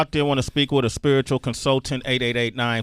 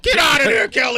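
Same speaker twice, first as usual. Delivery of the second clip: higher, louder playful yell; teasing shout; 12 semitones higher, 7 dB louder than usual. Real, not acted. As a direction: holler it at her across the studio. Teasing host yells at Kelly.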